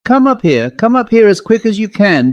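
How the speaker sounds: mild mock scream up high. A narrator's reading. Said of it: "I screamed out".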